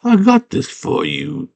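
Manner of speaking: high put-on character voice, drawn out; high-pitched mock creature voice; Hago. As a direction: offer it in a high, slow character voice. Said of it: "And Hago goes".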